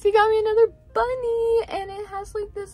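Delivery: high, loud burst of glee; excited announcement. Real, not acted. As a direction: announce the next gift with a thrilled shout. Excitedly presenting another bunny.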